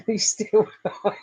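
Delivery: repeating the joke through laughter; laughing quote. Retold or imitated. Streamer quoting a viewer's joke back while laughing. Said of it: "You went".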